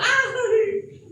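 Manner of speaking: high mock wail with a laugh; mock emotional cry. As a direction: parody a gushing believer. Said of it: "Y grita". (grito burlón de emoción)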